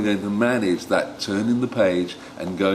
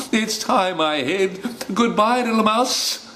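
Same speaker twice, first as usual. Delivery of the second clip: snake hurrying off; 12 semitones higher than usual. Acted. Snake fleeing in story.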